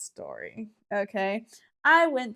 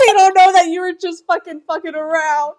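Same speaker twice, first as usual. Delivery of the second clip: high, very loud words through laughter; helpless laughing outburst; 7 semitones higher, 11 dB louder than usual. Real, not acted. Sister to brother about his misunderstood joke, laughing loudly. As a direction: wail it out while cracking up.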